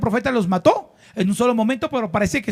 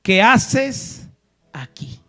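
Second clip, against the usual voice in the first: punched then falling to a hush; God's question to the listener; 4 semitones lower, much slower than usual. Acted. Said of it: "la voz del Señor que nos diga".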